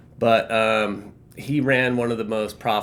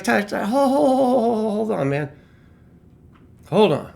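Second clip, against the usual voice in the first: raised, insistent retold interjection; acted interruption; 11 semitones higher, 4 dB louder than usual. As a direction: mimic cutting off a ranter, stretching the word hold. Acted interruption of his rant.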